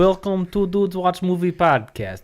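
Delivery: a bad mock Russian accent for the podcast intro; poor Russian accent. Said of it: "your poor Russian accent".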